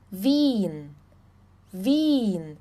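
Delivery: Wien with a long i, VEEN, no e; German ie. Shown as a demonstration. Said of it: "o som de I E juntos tem o som de I".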